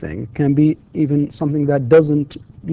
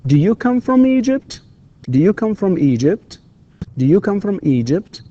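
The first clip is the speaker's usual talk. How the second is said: higher-pitched drill question, "Egypt" going up; rising intonation; 6 semitones higher than usual. Shown as a demonstration. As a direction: clear upward tune ending on "Egypt". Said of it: "rising intonation".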